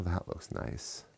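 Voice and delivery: soft, slow admiring whisper. Real, not acted. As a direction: breathe it out admiringly. Admiring the case up close.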